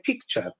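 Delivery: "picture" with a tense Polish i; "picture" as "peekture"; Polish vowel. Shown as a demonstration. Teacher showing the Polish mistake in "picture". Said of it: "they say".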